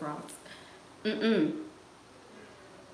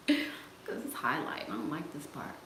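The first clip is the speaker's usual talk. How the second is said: ordinary talk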